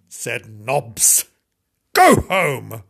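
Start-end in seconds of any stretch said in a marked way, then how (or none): 1.9-2.8 loud, stern dog's command; stern order. Acted. Dog sending the kitten home, stern order.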